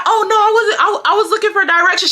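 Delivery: high-pitched mock protest; caught-out excuse. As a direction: imitate someone hurriedly denying it. Retold excuse from caught-out woman.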